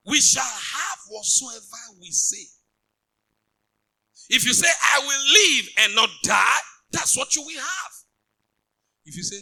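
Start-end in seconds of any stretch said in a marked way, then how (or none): 0.1-2.5 slow, loud preaching proclamation; preaching the promise loudly; fervent declaration. Acted. 4.3-7.9 high, loud preaching shout; preacher shouting the confession of life; shouted declaration. Acted.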